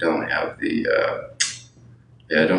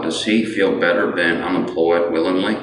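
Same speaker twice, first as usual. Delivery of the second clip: normal talking voice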